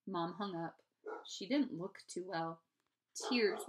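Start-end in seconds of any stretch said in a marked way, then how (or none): none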